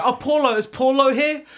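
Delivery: imitated caller. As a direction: call out lightly for someone by name. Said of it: "They go".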